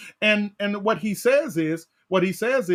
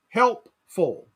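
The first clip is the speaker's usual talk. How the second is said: ordinary delivery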